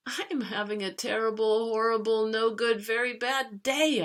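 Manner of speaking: a kid declaring his bad day to the whole family; loud complaint. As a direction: announce your misery to everyone. Complaining loudly to everybody.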